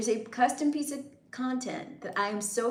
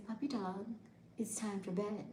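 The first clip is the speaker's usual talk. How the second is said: softer, hushed bedtime line; soft bedtime whisper; 11 dB quieter than usual. Shown as a demonstration. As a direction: whisper it to a pet. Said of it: "I'll say".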